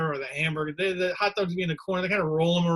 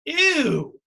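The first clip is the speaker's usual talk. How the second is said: high mock disgusted squeal of ew; 10 semitones higher, 7 dB louder than usual. People hearing about the ham dog, disgusted squeal. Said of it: "They would always say something like".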